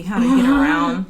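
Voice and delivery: laughing through the words. Real, not acted. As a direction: chuckle while saying it. Laughing while eating, trying to bite.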